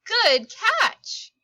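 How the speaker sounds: high, loud, glad call; cheering. Acted. Ella calling good catch to Raya, loud and bright. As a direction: shout it out as a real compliment.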